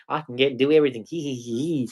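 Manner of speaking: sly, hushed weasel voice with a little snigger; mocking Albanese. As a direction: play a smug politician snickering quietly to himself. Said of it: "weasels his way through it because he's the Prime Minister".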